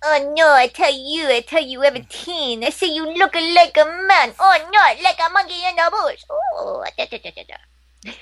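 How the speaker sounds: high, put-on broken-accent voice; Miss Swan impression. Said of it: "my one Miss Swan".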